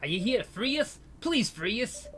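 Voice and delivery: high, pleading captive's voice; a frightened prisoner begging a stranger. Acted.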